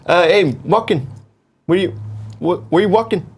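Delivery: Robert De Niro. Acted. Film star greeting Walken, halting impression. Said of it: "I like Walken versus, uh, De Niro".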